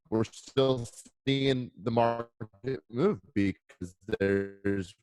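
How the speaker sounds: audio dragging slow from a bad connection; glitchy slowed-down internet audio; speed ramp. Real, not acted. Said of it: "his voice slowed down".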